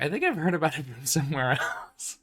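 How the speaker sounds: light laugh breaking into the line; laughing quote. Retold or imitated. The friend politely deflecting the guy's spiel, laughing. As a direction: chuckle through the brush-off.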